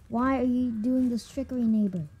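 ordinary talk, nothing marked

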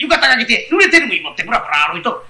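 normal talking voice

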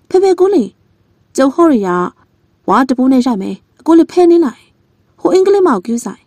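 high loud urgent warning calls; warning shout. Acted. Old man shouting warnings to the young man, alarmed. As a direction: yell to warn them of danger.

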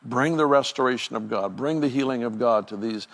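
ordinary talk

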